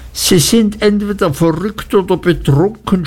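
high, desperate outcry; desperate cry. A narrator's reading. Spinster shrieks at the aunt in despair, high desperate cry. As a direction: cry it out, at her wits' end. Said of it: "schrie Miss Murdstone in wahrer Verzweiflung".

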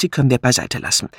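usual speaking voice

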